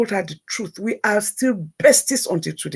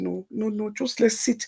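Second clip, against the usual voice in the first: soft insistence; 2 semitones higher, 8 dB quieter than usual. Retold or imitated. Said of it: "I said".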